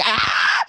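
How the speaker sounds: loud high mock yelp of pain; retold pained yelp. Mom's loud yelp as bleach hits her eyes. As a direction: yelp like mom hit by spray. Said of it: "she was like".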